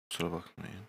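quiet, right after a sip of tea; apologising after a sip of tea; just swallowed tea. Real, not acted. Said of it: "Çayımdan bir yudum alıyorum". (çay yudumladıktan hemen sonra)

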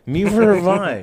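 high loud sing-song repeat; excited. Shown as a demonstration. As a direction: bounce it up with glee. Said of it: "repeated louder, higher".

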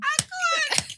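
high squeal through laughter; helpless laughter. Real, not acted. Friend unable to cope with the story, laughing helplessly. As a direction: give up, overcome with laughing.

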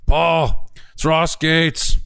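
imitating an eager caller blurting news; excited Ross on the phone. Retelling Ross's excited phone call.

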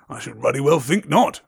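loud, growling Hagrid voice; growled. Acted. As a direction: growl it in gruff disapproval. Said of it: "growled Hagrid".

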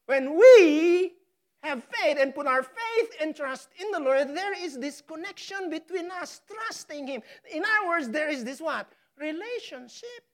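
high, loud preaching climax; preaching at full force. Acted. Preacher stirring the congregation, full-force preaching. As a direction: drive it home to the flock.

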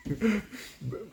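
high burst of laughter; real amused laugh. Real, not acted. Laughs after story.

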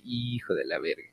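soft, low curse under the breath; muttered insult. Retold or imitated. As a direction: spit the insult quietly. Insulting the friend who betrayed. (insulto murmurado en voz baja)

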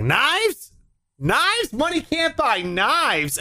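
high, slow, baffled "Knives? Knives? Money can't buy knives."; Rob Schneider impression. Comic retells the movie line, high and slow. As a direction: squeak out "knives" in disbelief, slowly. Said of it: "He's like".

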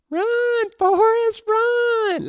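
Forrest Gump quote. Retold or imitated. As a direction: shout him on like in the movie. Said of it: "like Forrest Gump".

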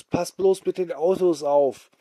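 warning call. Acted.

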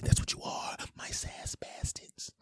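slow, fading whisper. Shown as a demonstration. Host naming his listeners, whispered. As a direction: sink into a drawn-out whisper.

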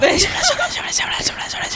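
rapid whispered gibberish chant; imitated whispering. Mimicking library whisperers chanting round a circle, imitated whispering. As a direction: hiss out nonsense syllables under the breath. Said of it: "the whispering thing is a bit weird".

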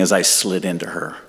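ordinary delivery

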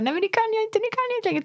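high, pinched "I can't anymore"; overwhelmed protest. Pretend. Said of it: "Tills dess att du känner så här".

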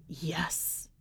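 bare whisper, unhidden displeasure; whispered yes. Acted. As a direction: breathe a resentful yes. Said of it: "She doesn't try to hide it".

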